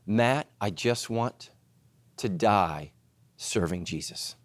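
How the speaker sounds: slow, soft words of a sick man; frail dying pastor. Retold or imitated. Sick pastor telling Matt his last wish, soft and slow. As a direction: quietly carry a dying man's wish. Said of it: "He said".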